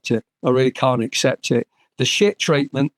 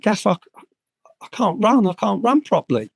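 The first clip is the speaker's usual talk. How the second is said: higher-pitched mock plea; retold protest; 7 semitones higher than usual. Injured player tells his manager he can't run, protesting. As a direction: protest like a player reasoning with the boss.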